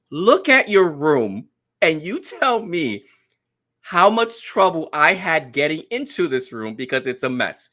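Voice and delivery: high, loud mock scolding, hammering each point; exasperated dad lecture. Dad telling daughter her room is a mess, comic exasperation. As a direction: act out a worked-up parent's rant.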